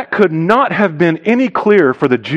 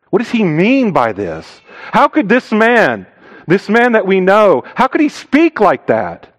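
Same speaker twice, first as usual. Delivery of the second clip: higher-pitched, indignant disbelief; incredulous grumbling; 5 semitones higher than usual. Acted. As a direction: act out a scandalized listener questioning him. Acts out grumbling, disbelieving listeners.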